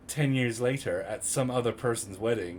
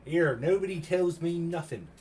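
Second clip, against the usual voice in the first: higher, drawn-out comic complaint in a put-on voice; hammed-up slow whine, pitched up; mock grievance; 6 semitones higher than usual. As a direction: play up being left out, slowly.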